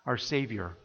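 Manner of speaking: ordinary talk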